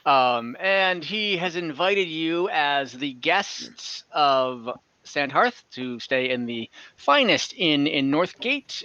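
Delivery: ordinary talk, nothing marked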